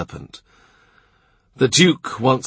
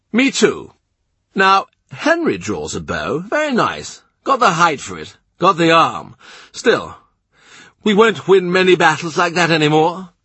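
the blustering Duke of Norfolk, pitched higher; gruff duke; 7 semitones higher, 3 dB louder than usual. A narrator's reading. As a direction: play the proud, impatient duke. Duke on archery.

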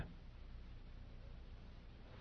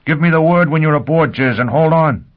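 urgent shout; 8 semitones higher, 6 dB louder than usual. Acted. Foyle shouting to Gisbella.

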